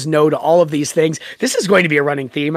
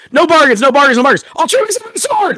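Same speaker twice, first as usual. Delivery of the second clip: louder, higher, insistent No bargains; urgent refusal; 9 semitones higher, 4 dB louder than usual. Acted. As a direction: cut off the bargaining with a raised voice. Michael refusing, acted quote.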